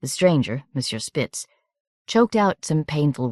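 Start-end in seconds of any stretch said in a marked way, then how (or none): none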